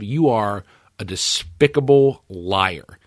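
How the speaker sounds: ordinary talk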